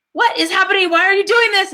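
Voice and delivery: high, exasperated mock outburst; mock exasperation. Mother mad at son who kicked balloon, high mock exasperation. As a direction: throw up your hands, hammed-up and high. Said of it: "she was getting mad".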